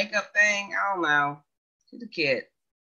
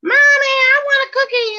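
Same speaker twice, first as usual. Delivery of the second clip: put-on high, pleading child voice; little girl begging mommy; imitating little daughter; 5 dB louder than usual. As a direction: whine it like a small kid asking for a cookie. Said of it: "My younger daughter is like".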